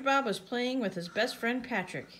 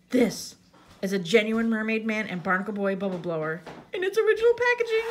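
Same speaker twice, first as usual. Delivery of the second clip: SpongeBob; 2 semitones higher, 5 dB louder than usual. A narrator's reading. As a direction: present it proudly, building to a squeal. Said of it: "SpongeBob trembled with excitement".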